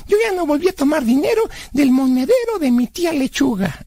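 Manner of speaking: put-on high-pitched kid pleased with his deed; high cartoonish self-justification; childlike Blas, self-justifying. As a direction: boast naively in a high character voice.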